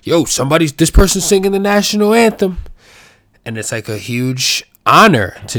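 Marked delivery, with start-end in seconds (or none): 0.0-2.6 excited hype. Retold or imitated. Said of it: "somebody who's like".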